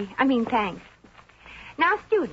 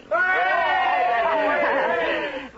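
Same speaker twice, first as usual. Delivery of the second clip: crowd laughter; 4 semitones higher than usual. Acted. Class laughter.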